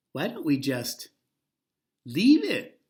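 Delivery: timid suggestion. Acted. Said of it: "suggested Ernestine timidly".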